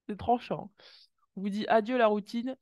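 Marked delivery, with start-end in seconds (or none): 1.4-2.6 much louder, higher emphatic declaration; emphatic repeat. Real, not acted. Reader repeating the card message to viewers, loud. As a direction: drive the message home.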